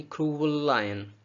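क्रूअल लायन joined by a single L; one shared L. Shown as a demonstration. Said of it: "एक ही एल बोलना है". (क्रूअल लायन में एक ही एल)

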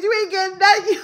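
laughing shout. Real, not acted. Telling her son he got nothing, laughing. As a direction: yell it through tears of laughter.

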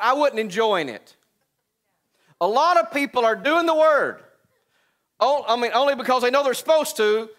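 loud, high preacher's cry; preaching at full force. Acted. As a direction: preach it out to the crowd.

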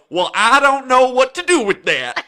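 put-on very loud, high Southern accent; big Southern caricature; thick Southern accent. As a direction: belt it out in a hammed-up Southern accent. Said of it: "the most thick Southern accent".

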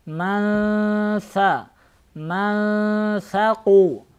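slow "Ман са" with a long nasal hum on н; tajweed ghunna held through "Ман"; ikhfa with ghunna. Shown as a demonstration. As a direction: hold a hidden, nasalized н in "Ман саку". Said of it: "Тут нужно ихфа делать. Гунна".

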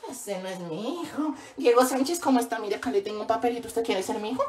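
put-on mom coolly denies her own child to the priest; mock disowning mom. Mother disowning her in church.

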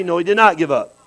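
ordinary talk, nothing marked

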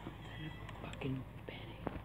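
slow, very soft whisper; hushed, frightened swearing; panicked whisper. Real, not acted. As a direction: whisper it slowly in fear. Scared player muttering in panic.

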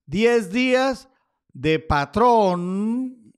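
very slow, words stretched out; slow dictation while writing. Real, not acted. Labelling the chart for viewers, drawn out slowly. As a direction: spell it out at the pace of the pen. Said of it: "ahí va a decir patrón".

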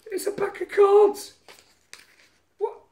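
high, loud Scouse squeal; mock Scouse accent. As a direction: shriek it in a put-on Scouse accent. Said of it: "Scouse sort of accent up thingy".

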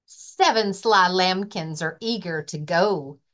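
ordinary delivery

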